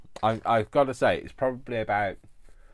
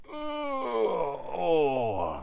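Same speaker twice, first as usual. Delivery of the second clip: wistful cry; 10 semitones higher than usual. Real, not acted. Wistful cry before hug line.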